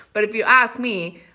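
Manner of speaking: ordinary talk, nothing marked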